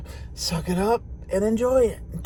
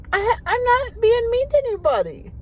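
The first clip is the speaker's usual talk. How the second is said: put-on much higher, a little girl's protest; high little girl insisting she's not mean; childlike inner voice; 3 dB louder than usual. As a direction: voice your younger self, high and innocent. Retelling her childhood thought.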